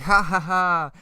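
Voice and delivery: high, loud villain laugh; hammed-up evil "ha, ha, ha". Reader voicing the villain laughing evilly, loud and hammy. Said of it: "he starts evilly, uh, laughing evilly".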